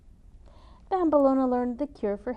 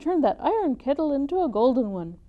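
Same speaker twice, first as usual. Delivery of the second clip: boastful excitement; 5 semitones higher, 6 dB louder than usual. Acted. Eager boastful announcement.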